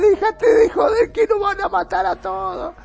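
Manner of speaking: high, loud frantic yell; begging the secretary in a terrified scream; panicked yelling. Retold or imitated. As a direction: shout it like your life depends on it. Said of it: "Y le gritaba".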